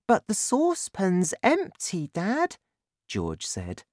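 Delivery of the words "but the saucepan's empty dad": put-on much higher child's voice; young son. A narrator's reading. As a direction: ask Dad in a high boyish voice. Narrator voices young son George.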